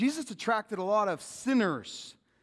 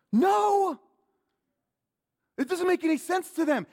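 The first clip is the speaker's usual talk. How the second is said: shout; 13 semitones higher, 9 dB louder than usual. Acted. Voicing the Pharisees' answer.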